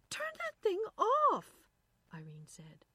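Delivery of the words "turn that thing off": high, loud, urgent command; panicked order. A narrator's reading. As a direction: cry out to shut it off. Irene alarmed at the radio.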